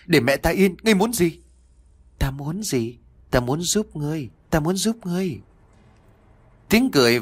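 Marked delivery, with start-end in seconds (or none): 0.0-1.4 high-pitched, mildly shouted demand. A narrator's reading. Long yells at the voice to leave his mother, mild shout. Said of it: "Long liền hét lớn". 2.2-5.4 softly voiced, higher-pitched spirit; lightly put-on voice of an unseen tempter; eerie voice. A narrator's reading. Unseen voice offers Long help, lightly eerie voice. Unseen voice answers Long's shout.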